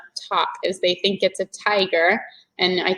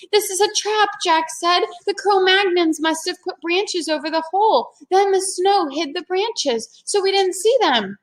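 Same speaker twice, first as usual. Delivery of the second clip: put-on higher boy voice working it out; Jack explaining; 9 semitones higher than usual. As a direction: Jack figures out the trap. Jack voiced in read-aloud.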